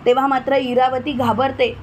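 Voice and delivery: ordinary delivery, nothing marked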